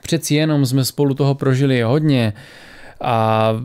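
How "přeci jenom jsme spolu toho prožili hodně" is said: ordinary delivery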